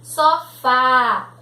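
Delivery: "Sofá" with a loud, open final á; open vowel. Shown as a demonstration. Teacher demonstrating the acute accent to pupils, "Sofá" with forceful open á. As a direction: punch the open á that ends "Sofá". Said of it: "som aberto".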